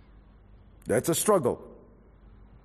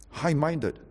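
ordinary delivery

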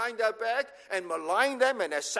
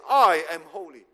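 I said louder and held; stress on I; 5 semitones lower, 5 dB louder than usual. Shown as a demonstration. Preacher stressing God's I to the church, emphatic. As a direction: punch the I in I am holy. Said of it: "the emphasis is in I".

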